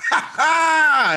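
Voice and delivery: high, hammed-up burst of laughter; mock drunken laughing. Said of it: "and laughing".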